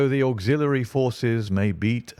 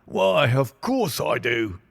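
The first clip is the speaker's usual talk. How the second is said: slow, wheezy, higher inspector voice; wheezing; 5 semitones higher, 3 dB louder than usual. Acted. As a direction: wheeze it out pompously. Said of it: "he wheezed".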